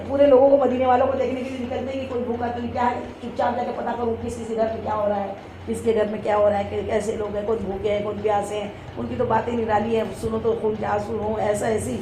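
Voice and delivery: low, soft, confiding narration; hushed storytelling. A narrator's reading. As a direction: drop down low and quiet, as if on a secret round. Telling of secret night rounds.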